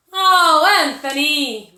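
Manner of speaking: a loud, high, fed-up cry of the name; exasperated sigh. A narrator's reading. Mother dismayed at her son's latest mess, loud exasperated cry. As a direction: drag out the Oh, then let the name fall in dismay.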